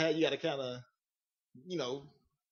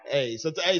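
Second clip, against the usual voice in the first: usual speaking voice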